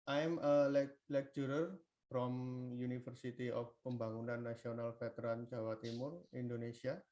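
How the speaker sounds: faint voice, far from the mic; words barely reaching the listener; barely audible. Real, not acted. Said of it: "we can't hear you".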